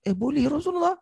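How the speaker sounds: put-on startled young man, high; a high, startled gasp of disbelief; surprised. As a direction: pitch jumps up in shock. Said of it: "Pemuda ni terkejut".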